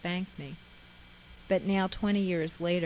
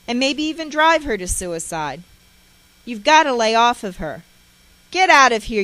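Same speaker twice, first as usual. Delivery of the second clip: loud, higher, throw her out; furious shouting; 6 semitones higher, 13 dB louder than usual. Retold or imitated. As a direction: rage at her, then order her out. My father talking real sternly to.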